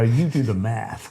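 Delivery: quick mock American accent; a throwaway American twang. Host tossing a quip to the audience, in an American accent. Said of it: "I did an American accent there".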